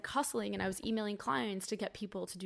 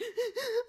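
sharp crying gasp; 6 dB louder than usual. Retold or imitated. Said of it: "And I was like".

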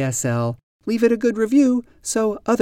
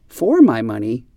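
"for" hit loud, rising tune at the end; wrong stress on FOR; 3 semitones lower, 5 dB louder than usual. Shown as a demonstration. Teacher giving another wrong example to learners, "for" stressed. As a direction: punch "for", then lift the pitch on "money". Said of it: "We never say".

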